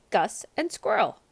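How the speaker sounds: ordinary delivery, nothing marked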